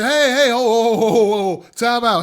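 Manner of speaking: an impatient producer waving people off, mock yell; mock Lee Daniels shout. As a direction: imitate Lee Daniels cutting people off loudly. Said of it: "He said".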